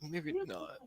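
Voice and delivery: high, soft admission; small doubtful voice. Acted. Game master voices player after failed try, small and doubtful. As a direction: squeak it out softly, giving up. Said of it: "you're like".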